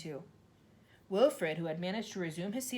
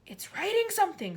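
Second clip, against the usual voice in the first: much higher, louder excited shout; screamed; 13 semitones higher, 7 dB louder than usual. A narrator's reading. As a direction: point and scream. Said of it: "screamed Nigel".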